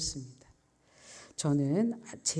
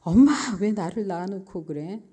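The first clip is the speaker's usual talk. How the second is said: retold childish protest, louder on the opening word; defiant; 2 semitones higher, 7 dB louder than usual. Child standing off from cursing mother, retold defiant protest. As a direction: talk back to mother, voice raised. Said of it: "엄마한테 대듭니다".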